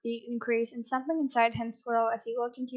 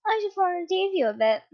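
teasing; 8 semitones higher, 3 dB louder than usual. Acted. Teasing partner.

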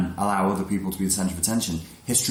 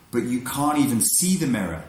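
normal talking voice

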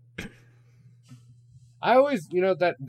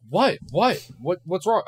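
mock alarm; 8 semitones higher, 7 dB louder, slower than usual. Stepmom's alarmed reaction retold.

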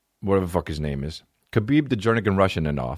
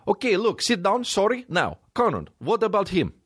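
much higher, hammed Russian accent; 12 semitones higher than usual. Khabib deflects again, hammed Russian accent. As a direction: brush off the press, pitched way up. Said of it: "Worst apology".

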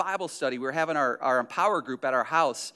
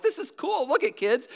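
high-pitched put-on enthusiasm; dad faking wonder at an upside-down fish; mock excited; 9 semitones higher than usual. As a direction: sell it to the kids as cool. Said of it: "I'm like".

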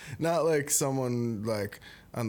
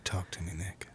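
soft unvoiced whisper; 4 semitones lower, 6 dB quieter than usual. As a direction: lean in and whisper it back. Leaning in for a secret.

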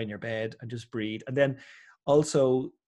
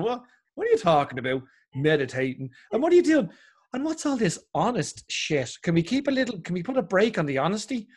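outraged family; 5 semitones higher than usual. Retold or imitated. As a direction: ham up the family's disbelief. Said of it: "they're literally going".

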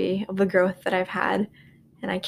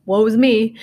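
a hammed-up tragic lament, a bit louder; mock woe; 6 dB louder than usual. To her listeners about fetching water down the hall, hammed-up woe. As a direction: play the martyr for laughs.

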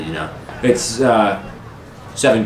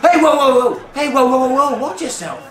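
high-pitched barkeep. Acted. Barkeep stops a thrown chair.

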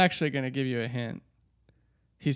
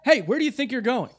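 higher, urgent call after her; Nick; 8 semitones higher than usual. Acted. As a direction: stop her from leaving. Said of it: "you're gonna play the role of Nick".

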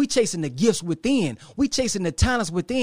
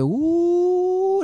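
impressed ooh; 12 semitones higher, 3 dB louder than usual. Retold or imitated. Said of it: "I said".

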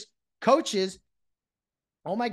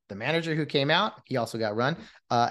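ordinary talk, nothing marked

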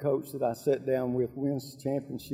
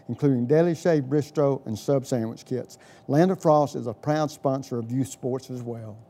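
louder, punchy sponsor ad read; 1950s ad; 5 dB louder than usual. Acted. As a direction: sell it like a radio commercial. Host reads sponsor ad.

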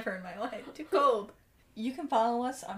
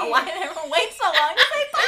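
higher, louder, laughing through it; 6 semitones higher, 13 dB louder, faster than usual. Real, not acted. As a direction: crack up while asking. Laughing after awkward goodbye.

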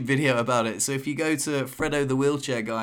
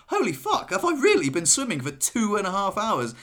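much higher, shocked exclamation; mock astonishment; 7 semitones higher than usual. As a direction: disbelief at two and a half hours. Retold shock at time.

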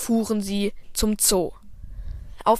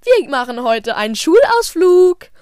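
loud, higher teacher voice; teacher calling out; 6 semitones higher, 9 dB louder than usual. Acted. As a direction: call the kids together like a teacher.

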